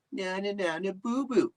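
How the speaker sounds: lightly voiced singsong taunt; a hint of a nah-nah-boo-boo chant; mock taunt. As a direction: tease like a playground bully, half-hearted. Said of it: "What'd he say".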